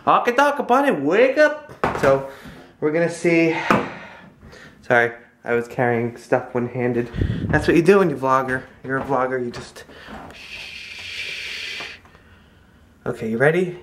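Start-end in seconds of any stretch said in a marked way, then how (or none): 0.1-1.7 high, playful wake-up call; mock wake-up call. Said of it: "be like". 10.3-11.8 unvoiced shush. Real, not acted. Filming near sleeping baby.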